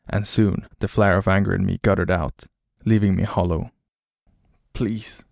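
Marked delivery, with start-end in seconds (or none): 4.7-5.2 soft, understated plea; begging. A narrator's reading. Hollowed-out traveler to the stranger, softly begging. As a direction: beg quietly, the anger spent. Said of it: "I begged".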